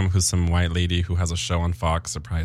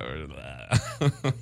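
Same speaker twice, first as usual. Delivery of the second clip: slow, soft relaxed drawl; 4 semitones lower, 6 dB quieter, much slower than usual. Shown as a demonstration. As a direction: stretch out "We're relaxed." slowly and softer. Says relaxed twice, second slowed.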